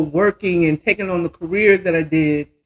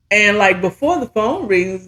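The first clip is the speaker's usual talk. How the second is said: ordinary talk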